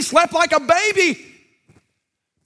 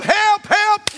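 higher-pitched mock cries for help; crying for help; 8 semitones higher than usual. The man calling for help during the fight, pitched higher. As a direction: call out for help, half acted.

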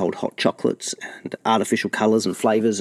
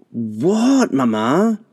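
much higher whiny reply; exasperated kid; 6 semitones higher, 4 dB louder, much slower than usual. A narrator's reading. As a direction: answer mum with annoyance. Kids answer mother.

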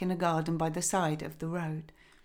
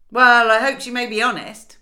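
much louder, doubting husband; a wary man eyeing the stranger; loud, sceptical husband; suspicious husband; 5 semitones higher, 12 dB louder than usual. A narrator's reading.

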